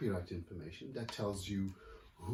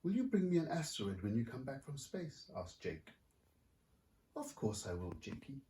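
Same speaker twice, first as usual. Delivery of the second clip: lively picture-book reading; story narration; 2 semitones higher than usual. A narrator's reading. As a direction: read it like to a room of kids. Author reads picture book aloud.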